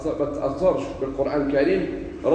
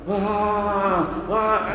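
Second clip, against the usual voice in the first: long, higher fake wail; mock wailing; 7 semitones higher than usual. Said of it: "كيما بعض الناس في التراويح".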